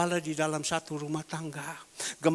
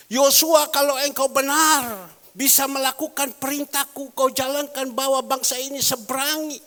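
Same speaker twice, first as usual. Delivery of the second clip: loud, high, commanding divine call; booming voice of God; 7 semitones higher, 10 dB louder than usual. Acted. God commanding Joshua, booming call. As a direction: proclaim it as God calling Joshua.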